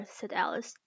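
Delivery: usual speaking voice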